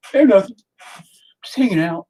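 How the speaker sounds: mock kid. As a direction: sound innocently casual like a kid. Imitating kids.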